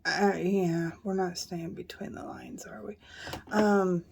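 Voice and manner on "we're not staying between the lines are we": whispering. Real, not acted. Whispered rueful aside.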